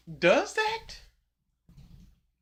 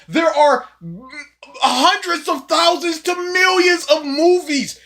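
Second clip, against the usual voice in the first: louder, higher indignant rant; angry rant; 4 semitones higher, 5 dB louder than usual. Real, not acted. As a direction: scold him, louder and louder. Ranting at the video creator.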